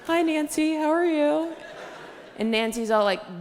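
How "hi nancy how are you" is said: high, chirpy mock greeting; mom as friend. Mom visits her imaginary friend, high mock greeting.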